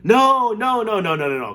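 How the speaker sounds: loud high no, fading down; crying out no. Acted. Wonka cries out to stop the boy, loud then fading. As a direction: panic and plead to stop him. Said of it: "cried Mister Wonka".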